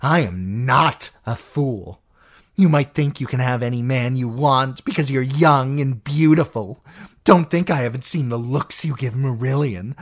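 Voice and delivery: possessive rage building; an aunt claiming Peter as hers; jealous, furious aunt. Acted. Lysa's jealous tirade.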